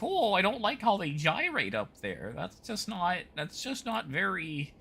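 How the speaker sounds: high, soft, scandalized Midwestern fusspot; pearl-clutching Midwestern accent. Pretend. Prude tutting at a Backstreet Boys poster, mock-scandalized Midwestern accent. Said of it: "someone who had clutched their pearls".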